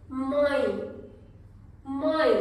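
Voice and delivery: माई with the vowel sent through the nose; nasal mãe. Shown as a demonstration. Teacher modelling a nasal माई for students. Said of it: "nasal sound, नाक से आवाज आ रही है".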